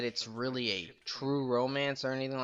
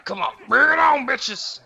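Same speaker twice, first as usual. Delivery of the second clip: taunting yell; 12 semitones higher, 10 dB louder than usual. Pretend. Taunting enemies in fight.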